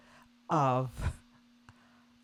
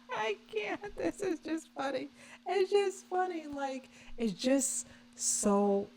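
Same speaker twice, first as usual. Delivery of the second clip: high, giggling words through real laughter; can't hold it together, laughing; laughing helplessly; 12 semitones higher, 4 dB quieter than usual. Real, not acted. Vlogger cracking up at the inauguration clip, high giggly laughing.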